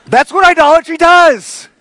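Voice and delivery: high, loud punchline; emphatic preaching. Real, not acted. Preacher to congregation after confessing his tantrum, loud and emphatic. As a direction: proclaim it at full voice to the room. Preacher drives home the point loudly.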